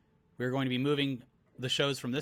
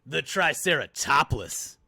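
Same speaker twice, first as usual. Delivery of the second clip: slow, louder punchline voice; girl voice; 5 dB louder, slower than usual. Acted. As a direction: drag out the pun as the girl. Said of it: "This is the girl talking".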